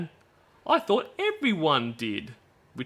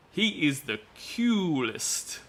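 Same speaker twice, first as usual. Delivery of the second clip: put-on girlish reading voice; 4 semitones higher, 3 dB quieter, slower than usual. Reading girl's chat message.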